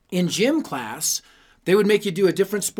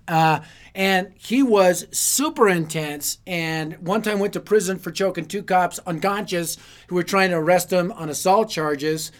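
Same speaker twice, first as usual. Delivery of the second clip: usual speaking voice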